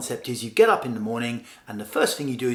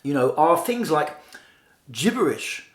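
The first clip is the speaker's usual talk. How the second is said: usual speaking voice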